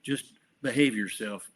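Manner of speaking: a light take on Ronald Reagan, grandfatherly; Reagan impression. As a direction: imitate Reagan welcoming a guest. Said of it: "That's a really good Reagan".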